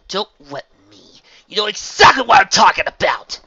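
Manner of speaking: rising to a loud, high accusation; furious accusing shout; angry accusation. A narrator's reading. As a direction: jab the blame right at her. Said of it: "Shiko accused".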